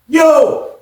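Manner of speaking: short high shout in reply; loud answering yo; calling back. Retold or imitated. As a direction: yell back down. Answering a call from afar.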